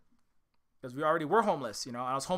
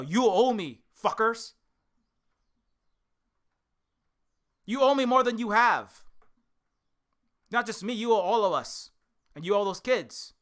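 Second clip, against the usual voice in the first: angry demand; 3 semitones higher, 4 dB louder than usual. Real, not acted.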